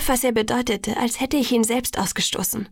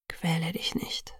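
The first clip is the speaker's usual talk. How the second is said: low, slow, hushed pleading; soft plea; 4 semitones lower, 9 dB quieter, slower than usual. A narrator's reading. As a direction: beg gently, almost whispering. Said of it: "bat er". (leise, langsam und tief gebeten)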